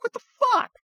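high startled what the fuck; a real jolt of surprise; shocked outburst. Retold or imitated. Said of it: "I'm like".

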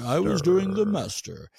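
high, swooping mock-announcer delivery; hammed-up broadcaster pronouncement; radio voice. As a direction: announce it grandly like a radio host. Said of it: "You gotta use the radio voice".

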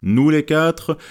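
lightly solemn raising of a glass, stress on les; a touch of ceremony in Nous les quatre; solemn toast. A narrator's reading. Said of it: "dit sur un ton solennel".

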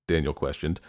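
ordinary delivery

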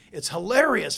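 usual speaking voice

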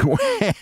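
a short high laugh; amused laugh. A narrator's reading. Mrs Barrows laughing as she lights his match. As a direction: laugh at him, delighted.

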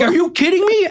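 high, squealed mock disbelief; stunned disbelief. Host hears Tom Hanks wants in, incredulous squeal. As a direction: squeak it out like you can't believe your luck. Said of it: "And I'm like".

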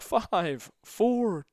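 loud, high imitation of a technician's call; calling out countdown. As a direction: belt out the count like a ride operator.

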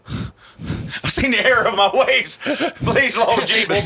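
high, loud, drawn-out plea to heaven; hammed-up cry for salvation, laughing; mock repentant addict. Joe swearing off her like an addict, mock desperate repentance. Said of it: "He's almost like a drug addict".

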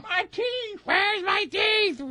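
put-on high, slow old lady wail; panicked granny who lost her teeth; loose dentures. Old woman jumper lost teeth.

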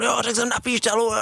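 high-pitched retort of an angry referee; imitated scolding, voice jumping up; mock angry yell. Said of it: "No hrozně mě seřval".

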